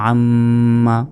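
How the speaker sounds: আম্মা with the ম্ম hummed long through the nose; held nasal ghunna. Shown as a demonstration. Teacher to students, showing obligatory ghunna with a held nasal hum. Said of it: "তাহলে গুণনা হবে".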